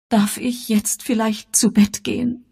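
high-pitched, mildly tearful plea; tearful whine. A narrator's reading. Daughter asking the adults to let her go to bed, tearful. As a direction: plead as if close to tears. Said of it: "fragte Elvira mit weinerlicher Stimme".

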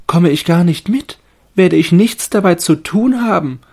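hohe, laute, enttäuschte Baumstimme; Stimme des Baumes. A narrator's reading. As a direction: als übergangener Tannenbaum klagen. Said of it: "blieb ganz still und dachte". (hohe, klagende Baumstimme)

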